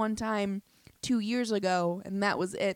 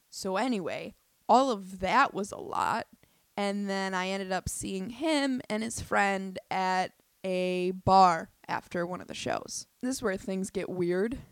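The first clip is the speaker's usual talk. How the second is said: ordinary talk, nothing marked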